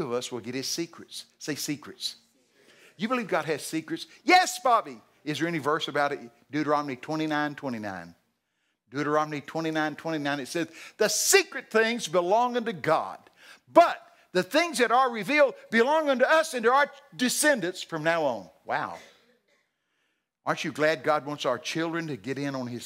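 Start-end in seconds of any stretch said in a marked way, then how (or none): 4.2-5.0 high, loud answer; emphatic yes. Pretend. God answering the preacher, loud and emphatic. God's reply voiced. 11.0-18.5 booming verse. Acted. Preacher proclaiming Deuteronomy to the congregation.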